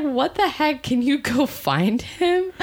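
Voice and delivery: high, slow, incredulous pleading; exasperated disbelief. Retold or imitated. Wife to receptionist who lost her husband, high incredulous plea. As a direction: stretch it out like you can't believe this is happening.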